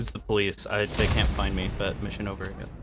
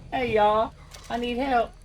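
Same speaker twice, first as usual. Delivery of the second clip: high mocking call for help; hammed-up, high-pitched helpless call; mimicked cry for help; 13 semitones higher, 3 dB louder than usual. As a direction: parrot a stranger's plea in a high voice. Mimics game character's cry for help.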